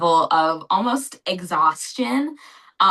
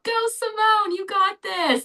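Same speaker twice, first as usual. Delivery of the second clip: imitated cheering shout. Racers on the staircase urging Simone on, imitated cheering shout. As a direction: mimic a crowd's cheer, pitched way up. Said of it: "on the staircase to shout".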